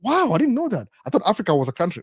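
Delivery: high "wow" falling, impressed; amazed. Retold or imitated. Sister-in-law hearing of Kenya's tribes, high and amazed.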